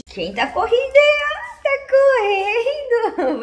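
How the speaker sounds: high, loud squeal at the running dog; excited puppy talk. Real, not acted. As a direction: squeal with delight as the dog runs up.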